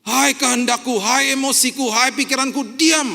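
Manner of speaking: loud, high command to his own soul; commanding shout. Acted. Preacher commanding his own soul to be silent. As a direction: order the will and mind to be still.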